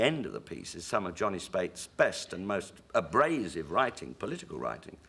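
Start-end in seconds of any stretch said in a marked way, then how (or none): none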